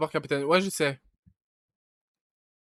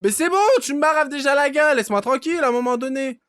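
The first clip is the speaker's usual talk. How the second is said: loud, higher fed-up shouting; exasperated yell; 8 semitones higher, 8 dB louder than usual. Real, not acted. Gamer to the creature biting him, loud exasperated yelling. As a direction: shout at it to leave you alone.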